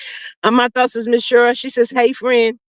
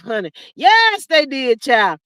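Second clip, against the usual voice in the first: ordinary delivery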